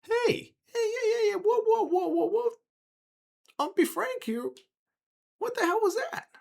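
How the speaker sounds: very high, squealy protest; high-pitched squealing character, rattled; Mock. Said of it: "his voice goes back to its high-pitched squealiness".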